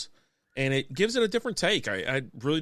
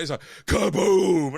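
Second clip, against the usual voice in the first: louder, slow kaboom; mock crash sound; 3 semitones higher, 5 dB louder, slower than usual. Telling of landing on hardwood, a slow boom.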